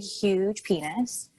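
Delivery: slow, stretched-out emphasis; drawn out. Real, not acted. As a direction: linger on huge for laughs. Stretched for comic effect.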